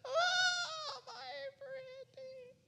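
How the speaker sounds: high shrieking crying witch wail; melting witch. Retold or imitated. Preacher playing the witch melted by water, shrieking. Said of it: "you throw water on your body and it goes".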